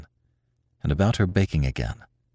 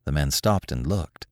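plain reading voice

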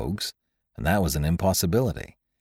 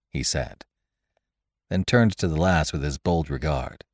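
ordinary delivery

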